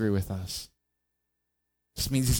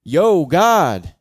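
high, loud call up to God; raised voice; 6 semitones higher, 9 dB louder than usual. Acted. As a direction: yell out to get God's attention. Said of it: "Asaph raises his voice".

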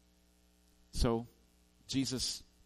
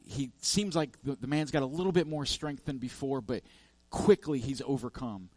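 ordinary talk, nothing marked

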